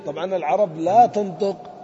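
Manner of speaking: usual speaking voice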